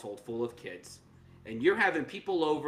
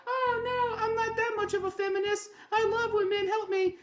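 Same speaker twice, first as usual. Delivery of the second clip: much higher put-on pleading voice, hammed up; mock pleading voice. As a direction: squeak it out like someone helpless begging for rescue. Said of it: "And the W N B A is like".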